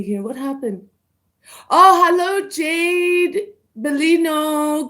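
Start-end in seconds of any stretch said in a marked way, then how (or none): 1.7-4.9 bright, loud hello to a viewer; excited greeting. Real, not acted. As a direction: greet them with a big lift. Greeting a viewer in live chat.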